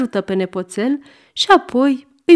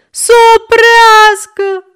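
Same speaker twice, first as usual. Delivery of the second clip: put-on a boy screaming, much higher and louder, slow; bawling child; 13 semitones higher, 9 dB louder, slower than usual. A narrator's reading. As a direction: stamp his feet and yell for the train to stop. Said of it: "zbiară și mai tare Goe". (Goe zbiară și bate din picioare, mult mai sus și mai tare)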